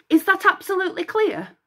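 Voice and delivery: commanding goddess voice; stern demand. Acted. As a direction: demand obedience from Echo. Hera demands.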